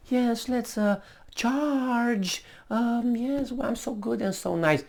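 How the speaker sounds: high, soft, prissy put-on voice; mock prim queen. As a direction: sweetly pretend to be a harmless queen. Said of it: "Just by being".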